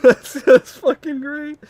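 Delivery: high, loud, slow words squeezed through laughter; real laughter. Real, not acted. Streamer cracking up at the video, laughing hard. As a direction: crack up helplessly while talking.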